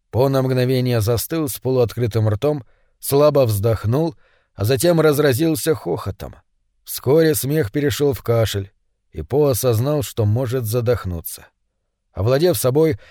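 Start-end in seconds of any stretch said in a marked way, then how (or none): none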